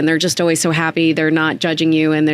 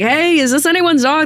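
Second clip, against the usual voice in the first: 1 asking around; 11 semitones higher than usual. Retold or imitated. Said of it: "I was like".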